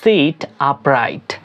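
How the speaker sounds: slow, clear instruction; teacher pronouncing slowly; slow demo. Shown as a demonstration. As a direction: model it word by word. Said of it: "সোজা হয়ে বসো".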